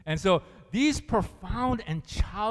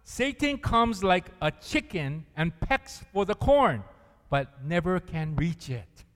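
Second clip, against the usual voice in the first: louder, slower earnest prayer; reading a prayer aloud; 3 semitones higher, 8 dB louder, slower than usual. Acted. Preacher reading the corn-in-a-bottle prayer aloud, earnest and loud. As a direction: lift the prayer up, slow and loud. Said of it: "he says".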